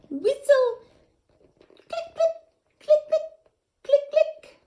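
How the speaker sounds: very high, loud dolphin clicking; playful dolphin talk, high and loud; dolphin clicks. Acted. Dolphin talk sounds in story.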